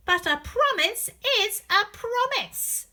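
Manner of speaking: high, loud, bossy princess; not very nice. Acted. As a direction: insist like a spoilt princess. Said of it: "she doesn't sound very nice".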